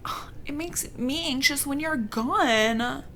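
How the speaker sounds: high, slow, swooning imitation; hammed-up pining girlfriend; mock lovesick. Girlfriend telling the vampire she misses him, mock lovesick.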